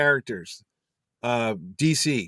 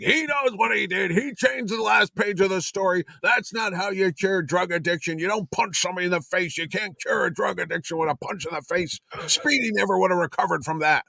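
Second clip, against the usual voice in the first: mock outrage in a raised, insistent voice; retold indignant rant; 5 semitones higher than usual. Writer griping about his changed story ending, acted indignant rant. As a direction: imitate an outraged writer, pitched up.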